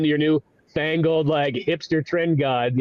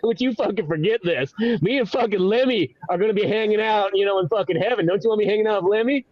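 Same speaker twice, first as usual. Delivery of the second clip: high, rowdy boasting character; pitched-up swaggering party voice; Jesus; 5 semitones higher than usual. Acted. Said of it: "He's like".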